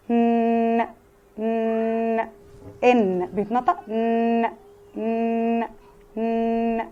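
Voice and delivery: ن held through the nose, mouth open; teacher drilling the n sound; n sound demo. Shown as a demonstration. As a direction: hum a steady nasal n. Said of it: "بفتح بقي وبتنفس من مناخيري".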